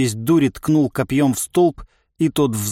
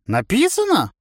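cackling toothless ranting; 11 semitones higher than usual. Acted. Old man baffled.

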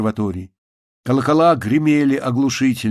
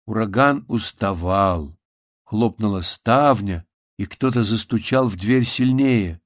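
ordinary delivery

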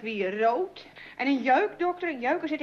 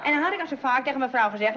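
usual speaking voice

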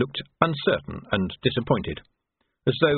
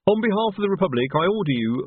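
Reg; 8 semitones higher than usual. A narrator's reading. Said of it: "Reg began".

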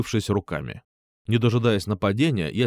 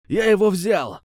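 triumphant yell, high and loud; shout; 9 semitones higher, 5 dB louder than usual. Acted. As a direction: call out to his gang in triumph. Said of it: "крикнул чувак".

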